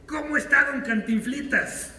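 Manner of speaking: a hammed-up cheeky interviewer; teasing reporter. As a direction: needle him with a falsely sweet question. Reporter teases Cantinflas.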